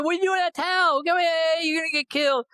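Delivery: high, fast, alarmed plea; panicked call. Retold or imitated. Friend calls the sergeant back from the snake, high and panicked. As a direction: hurry him back before he's bitten.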